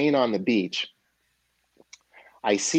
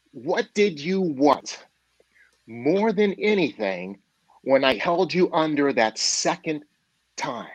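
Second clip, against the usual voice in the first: measured phrases with pauses, starting higher; slow, deliberate questioning; 4 semitones higher than usual. Acted. Old man to the gasping young man, slow deliberate questioning. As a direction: draw it out like a mentor making a point.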